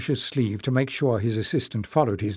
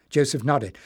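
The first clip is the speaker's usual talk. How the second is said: plain reading voice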